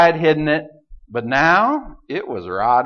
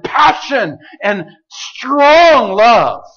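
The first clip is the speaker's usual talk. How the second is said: booming voice of God; 7 semitones higher, 4 dB louder than usual. Acted. God promising hammer-hard prophets, in a loud impassioned proclamation. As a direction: thunder out the promise like a preacher on fire.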